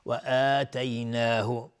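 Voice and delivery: "آتیناه" slow, long opening aa; correct long madd. Shown as a demonstration. Teacher gives the right word, "آتیناه" slowly stretched. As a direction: draw out the first vowel of "آتیناه". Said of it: "آتیناه یعنی بهش دادیم".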